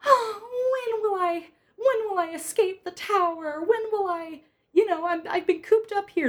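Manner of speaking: high, pining mock princess; wistful high-pitched storybook maiden; fairy-tale girl. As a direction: lament like a hammed-up damsel in a tower. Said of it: "starting with a girl going".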